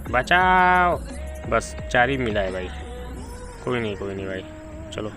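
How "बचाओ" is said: high, loud mock cry for help; Shreeman Legend. Gamer listing the pack's lines, high mock cry for help. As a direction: stretch it into a mimicked plea. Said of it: "Shriman legend में".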